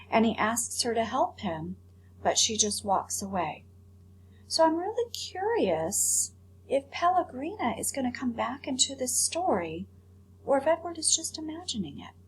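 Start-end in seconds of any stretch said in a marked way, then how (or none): none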